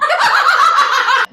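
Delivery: loud, high burst of laughter; laughing. Real, not acted. Host finishes reading funny dialogue, loud high laughter.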